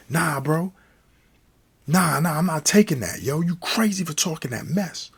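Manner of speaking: high-pitched indignant refusal; acted-out heated objection; worked-up protest. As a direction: argue back while being held off. B rants angrily while held back.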